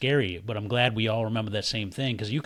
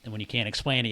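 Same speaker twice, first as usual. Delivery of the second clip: usual speaking voice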